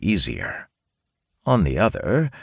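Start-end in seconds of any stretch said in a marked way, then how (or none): none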